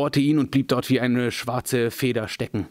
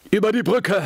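much higher, louder wizard's shout; urgent call; 10 semitones higher, 4 dB louder than usual. A narrator's reading. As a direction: call them across the bridge. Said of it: "rief Gandalf".

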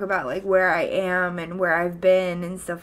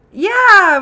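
excited yeah; 10 semitones higher, 12 dB louder than usual. Real, not acted. Excited about buying a house.

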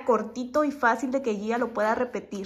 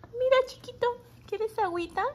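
calling the new puppy over, high and coaxing; 9 semitones higher than usual. Real, not acted. Talking to the puppy.

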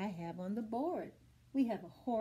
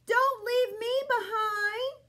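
much higher, louder crying duck; whiny duck; 10 semitones higher, 4 dB louder than usual. Acted. Duck pleads not to be left behind, wailing high. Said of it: "says Duck".

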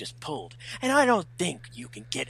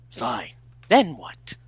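exasperated; 8 dB louder, slower than usual. Acted. Exasperated challenge.

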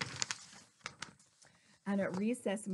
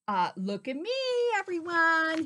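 mildly raised storybook call; show-off dinosaur calling out; shouted; 6 semitones higher, 6 dB louder than usual. A narrator's reading. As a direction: call out for attention, rising at the end. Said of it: "he shouted".

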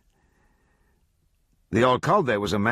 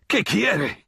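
high, choked question; hanged old man begging in Spanish; strangled plea; 12 semitones higher, 3 dB louder than usual. A narrator's reading. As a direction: gasp it out of a tight throat. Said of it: "he gasped".

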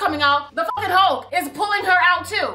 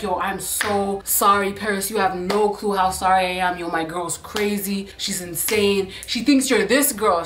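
put-on apologetic boyfriend; 7 semitones lower than usual. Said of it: "he's like".

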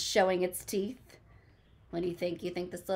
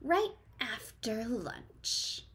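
soft, slow, breathy hissing snake; 2 semitones higher, 4 dB quieter, much slower than usual. Acted. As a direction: hiss it slowly like a menacing snake. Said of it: "said the snake".

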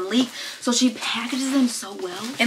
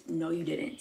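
very soft, lower disbelief; hushed disbelief; 5 semitones lower, 12 dB quieter than usual. Real, not acted. Vlogger to generous friend, hushed disbelief. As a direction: drop it right down, almost to yourself. Repeats disbelief under breath.

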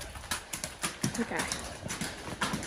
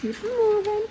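high coaxing call; 11 semitones higher than usual. Real, not acted. Calling cows.

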